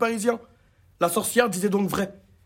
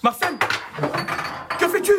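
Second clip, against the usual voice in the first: much higher, alarmed call; shocked at the noise; startled call; 8 semitones higher than usual. Acted. Bibeau calls out to his dog after a crash, startled and high. Hears dishes breaking.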